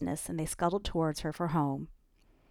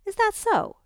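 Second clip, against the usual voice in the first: sharp, much higher and louder retort; temper flaring; 8 dB louder than usual. A narrator's reading. Ellie retorting to Annika, temper flaring. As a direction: flare up and bite back. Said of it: "her temper flaring now".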